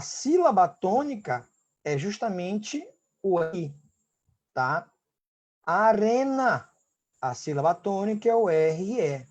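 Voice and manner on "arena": Arena drawn out slowly with the weight on RE; slow teaching stress on the RE of Arena; stressed model word. Shown as a demonstration. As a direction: say Arena slowly, leaning on the RE syllable. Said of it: "Quando eu pronuncio arena".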